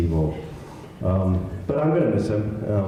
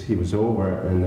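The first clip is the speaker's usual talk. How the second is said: ordinary delivery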